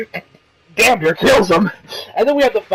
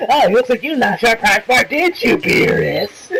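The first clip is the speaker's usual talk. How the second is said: teasing voice that climbs higher, stretching out the last words slowly; smug Champa taunt; 4 semitones higher than usual. Retold or imitated. Reviewer voices Champa taunting Beerus, lightly put-on smug drawl. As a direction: gloat at Beerus, dragging the tag question out. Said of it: "she's like".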